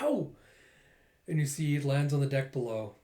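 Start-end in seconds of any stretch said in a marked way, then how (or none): 0.0-0.3 high-pitched "ow"; pained yelp. Acted. Dagger knocked flying from her hand, pained yelp. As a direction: yelp as if struck. Acts losing dagger in fight.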